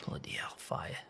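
breathy whisper echoing George's curse. Retold or imitated. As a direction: whisper it under your breath, teasing. Mimicking George after he leaves.

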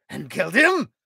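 high, loud eager question. A narrator's reading. King eager to hear the traitor died, high and loud. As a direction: lean in hungry for vengeance. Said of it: "asked Hrothgar eagerly".

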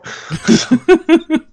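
loud, high real laugh bursting out; burst of laughter. Real, not acted. Host cracks up at the guest's job hint, laughing loudly.

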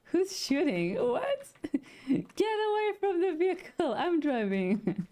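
high, squealed panic, drawn out at the end; mock yelling. Driver and friends arguing as they get shot at, mock yelling. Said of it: "same kind of yelling".

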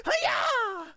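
high-pitched karate yell; playful fighter's kiai; battle cry. Pretend. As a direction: let out a drawn martial-arts cry. Martial-arts attack cry in game.

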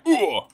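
high, loud game-character "ugh"; imitated Steve grunt. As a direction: mimic a hurt grunt. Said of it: "Steve's just like".

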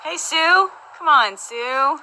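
high, loud call to Sue, drawn out at the end. Real, not acted. Host calling out to Sue in the chat, loud and high. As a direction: sing out her name to get her attention.

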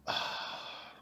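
a soft unvoiced ah of pleasure; satisfied breathy sigh. Listener imagining fresh winter air, soft sigh. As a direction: breathe out as if tasting crisp air.

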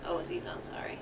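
whisper. Acted. Mourning alone.

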